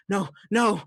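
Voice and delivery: high, loud panicked shout; alarmed cry. Acted. Brother reacting as the machine starts bleeping, high panicked shout. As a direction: yell it out in alarm. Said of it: "and he's like".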